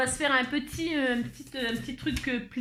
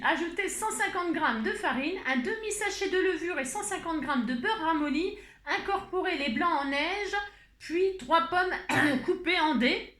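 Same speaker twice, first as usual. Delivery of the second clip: higher, presenter-like recipe reading; changed tone; 6 semitones higher than usual. Pretend. Host dictating an apple cake recipe to viewers, in a lifted presenter tone. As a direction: announce the recipe in a lifted, sing-song voice. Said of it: "Allez, on va changer de ton".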